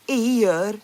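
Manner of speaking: "ear" with the L dropped; L left silent. Shown as a demonstration. Guessing a Swedish word aloud, L left silent in "ear". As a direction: say "ear" as a long vowel gliding to r, no L. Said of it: "I don't think you pronounce the L".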